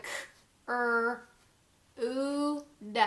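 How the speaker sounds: CRUDE broken into separate sounds k, er, oo, d; drawn-out phonics sounds k ... er ... oo ... d; sounding out. Shown as a demonstration. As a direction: say k, er, oo, d one sound at a time with gaps.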